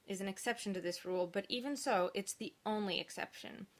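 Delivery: usual speaking voice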